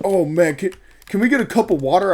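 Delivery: hammed-up customer begging a favor; mock pleading customer. Customer's request retold.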